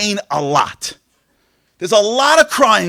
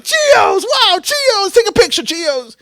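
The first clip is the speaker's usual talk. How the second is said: put-on high, thrilled praise of a toddler's word, several notes up and louder; excited parents; 11 semitones higher, 5 dB louder than usual. Parents cheering a toddler's first word, thrilled and high. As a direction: gush over the kid like proud parents. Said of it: "We're like".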